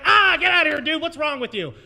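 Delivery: high, loud retold yell at the pantsless guy; mock shout of disgust, get him off the stage; shooing him off. Host yelling at a drunk comic who dropped his pants, loud mock outrage. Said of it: "And I was like".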